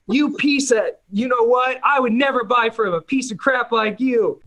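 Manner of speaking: high, indignant viewer voice; mock outrage. Offended viewer scolding him in the chat, high mock outrage.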